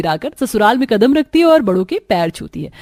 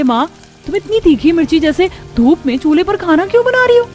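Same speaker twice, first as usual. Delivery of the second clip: shocked outcry; 7 semitones higher, 4 dB louder than usual. Acted. Daughter shocked at mother's cooking.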